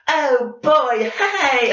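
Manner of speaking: put-on high, loud overdone British girl; cringy Tracer voice. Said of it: "She has a cringy voice. She overdoes it".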